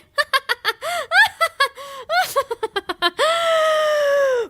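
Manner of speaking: long, high awkward laugh; forced laugh stretched out too long; nervous laugh. Acted. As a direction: laugh that climbs and keeps going. Nervous host stalls on air.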